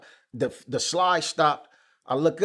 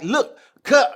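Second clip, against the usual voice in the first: high, loud urgent plea; high raised urgent plea; retold plea; 9 semitones higher, 6 dB louder than usual. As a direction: grab his attention, pleading. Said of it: "I'm like".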